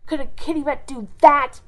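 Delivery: loud mocking dare; defiant growl. A narrator's reading. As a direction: throw it at him, triumphant. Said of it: "she growled".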